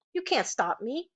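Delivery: plain reading voice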